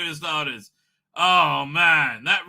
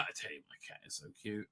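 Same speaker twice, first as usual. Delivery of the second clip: fast, much softer whispered gushing; 7 semitones lower, 21 dB quieter, much faster than usual. Real, not acted. Telling viewers about his cat, a fast fond whisper. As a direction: whisper it fondly and quickly.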